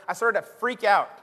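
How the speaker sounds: usual speaking voice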